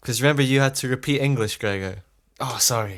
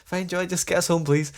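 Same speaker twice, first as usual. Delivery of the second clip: higher, shaky-nerved mock fear; quaking in boots; 8 semitones higher than usual. Shaken teen answering mum in the car, nervous. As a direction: act scared stiff but pretending fine. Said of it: "quaking in my boots".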